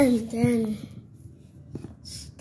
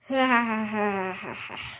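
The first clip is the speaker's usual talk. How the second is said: drawn-out mock evil laugh; villain laugh. Villain gloats at the newcomer, long evil laugh.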